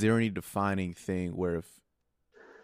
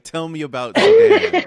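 high, loud laughing squeal of the question; mock quote laughing; 15 dB louder than usual. Host laughing as she mock-quotes the chat's question, high and loud. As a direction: squeal the question through a laugh, much higher.

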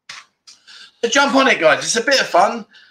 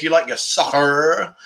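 drawn-out American pronunciation of soccer; bad American accent; slower than usual. Shown as a demonstration. British streamer courting American viewers, bad American accent. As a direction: put on a hammy American accent. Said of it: "worst American accent in the world".

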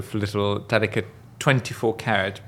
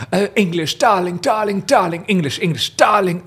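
posh English impression, higher and louder; posh English makeup lady; 8 semitones higher, 4 dB louder than usual. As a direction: gush it like a grand English beauty mogul. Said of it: "Oh, she always talks like this".

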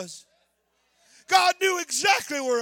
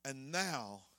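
soft whine; 13 semitones lower, 15 dB quieter than usual. Acted. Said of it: "the whole reason he was in the cave".

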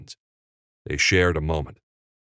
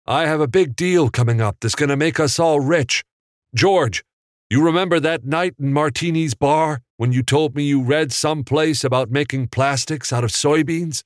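louder, higher voice carried from off camera; a brash businessman selling his big deal; calling out lines; 9 semitones higher, 4 dB louder than usual. A narrator's reading. [name] calls Sam's lines from off camera, loud and high. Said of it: "called out Sam's lines".

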